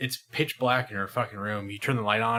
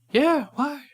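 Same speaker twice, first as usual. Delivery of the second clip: put-on much higher, innocent little-girl voice; child's voice; 5 dB louder than usual. Telling friends about the ex's kid, high innocent child voice. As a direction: answer like an oblivious small child.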